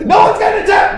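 very high, loud exasperated yell; dad yelling at his daughter after the bellhop mess; exasperated shout. Retold or imitated. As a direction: snap it at the kid, fed up. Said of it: "I'm like".

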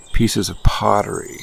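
usual speaking voice

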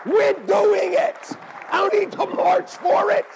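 high, loud and laughing, then slowing on "We're doing it"; emphatic retold shout. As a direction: insist with a laugh, then hammer it home. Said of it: "and I go".

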